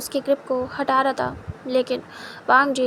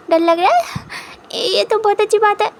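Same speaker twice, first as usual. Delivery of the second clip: much higher, louder, delighted; gleeful delight; 7 semitones higher, 4 dB louder than usual. Acted. Wing to his husband, thrilled he's scared, much higher and louder. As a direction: squeal with triumph at the news.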